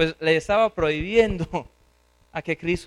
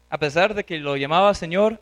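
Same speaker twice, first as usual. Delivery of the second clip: normal talking voice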